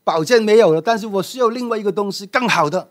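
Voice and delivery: a student rushing to ask for something better; eager disciple. Acted. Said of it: "赶紧的跑到师傅面前说".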